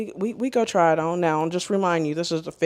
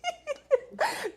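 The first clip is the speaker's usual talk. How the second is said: high, helpless giggle; high laughter; 5 dB quieter than usual. Real, not acted. Laughing at her own imitation of the caller, high giggle.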